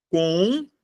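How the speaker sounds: each syllable alone with long pauses. Shown as a demonstration. Splitting the word by syllables.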